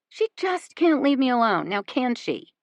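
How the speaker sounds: ordinary delivery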